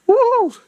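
high, drawn-out "woo"; excited whoop. Real, not acted. Preacher reacting to the promise of life, excited whoop. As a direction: let out a high whoop of joy. Exclaims at the good news.

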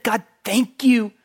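loud, high, eager thanks; a mock prayer of loud thanks; fervent thankful prayer. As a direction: pour out gratitude to God. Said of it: "have you ever just said".

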